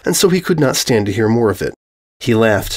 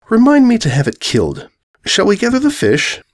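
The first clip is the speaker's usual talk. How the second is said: higher, lightly voiced cool command; regal dark blue mare; 11 semitones higher than usual. Dark blue mare ordering the ponies, cool and regal. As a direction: order a death offhand.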